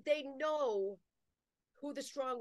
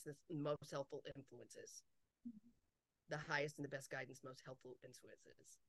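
low, very soft murmured mantra; quiet, trailing invocation; hushed recitation; 7 semitones lower, 11 dB quieter than usual. Shown as a demonstration. Repeating a habitual request to guides.

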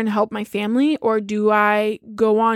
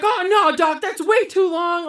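hammed-up protest at the doctor; dismayed parents; 8 semitones higher than usual. Parents protesting two weeks without sex, mock dismay. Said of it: "And they're like".

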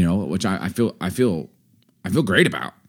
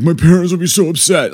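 higher, louder mock fretting; imagined worried daughter; 9 semitones higher, 7 dB louder than usual. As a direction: lightly voice a worried kid's thought. Daughter's imagined worry, voiced by parent.

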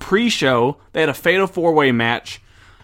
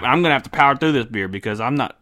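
ordinary delivery

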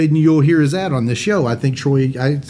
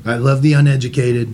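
Trump impression; 3 semitones lower than usual. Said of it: "quoting Trump".

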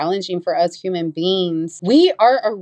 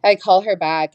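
ordinary delivery

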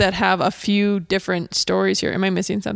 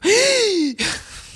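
high mock gasp of horror; shocked gasp; 13 semitones higher than usual. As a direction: gasp as if scandalized. Retold shocked reaction to swearing.

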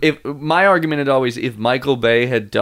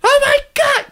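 a sudden, far higher cry of disbelief; shocked laughing outburst; surprised; 4 dB louder than usual. Real, not acted. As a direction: yelp it out in amazed delight. Picturing a wolf chasing a car.

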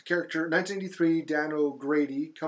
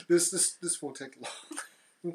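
laughing; much slower than usual. Real, not acted. Laughing through opening line.

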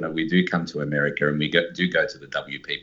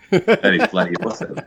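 laughing. Real, not acted. Guest laughing at compliment.

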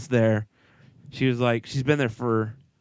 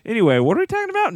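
fast, higher rush back; 9 semitones higher, much faster than usual. Real, not acted. The host rushes to steer back to nuts, fast. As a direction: hurry back to the topic.

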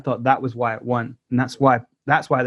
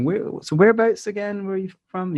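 higher-pitched imitated probing; suspicious questioning; 7 semitones higher than usual. Label man asking where they're from, mock suspicious. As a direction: mimic a man not buying it.

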